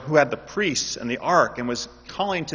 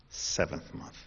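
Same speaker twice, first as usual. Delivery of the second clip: whispered answer; 8 semitones lower, 12 dB quieter, slower than usual. Shown as a demonstration. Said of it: "in what month?".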